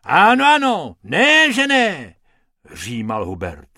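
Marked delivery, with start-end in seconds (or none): 0.0-2.1 high and loud; pushy hippo bluster; blustering insistence. A narrator's reading. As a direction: shout him down. Hubert insists over protest.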